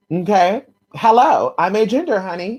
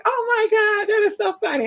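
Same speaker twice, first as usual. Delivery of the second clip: much higher, excited squeal; retold delight; 14 semitones higher than usual. Retelling the dogs meeting, high squeal.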